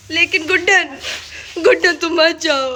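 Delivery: loud, insistent mock begging; mock pleading. Prankster blocking her sister from leaving, loud mock pleading. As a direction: plead noisily, overdoing it, name after name.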